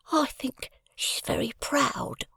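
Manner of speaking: put-on high, slow boyish whisper. A narrator's reading. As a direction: whisper it into her ear. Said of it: "I replied in a whisper".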